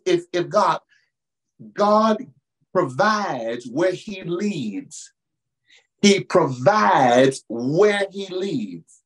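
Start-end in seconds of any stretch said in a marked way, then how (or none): 1.7-5.1 drawn-out, weighty line; very slow proclamation. Real, not acted. Preacher proclaiming God's provision, very slow. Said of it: "repeated slow proclamation". 6.0-9.0 drawn-out, weighty line; very slow proclamation. Real, not acted. Preacher proclaiming God's provision, very slow. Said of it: "repeated slow proclamation".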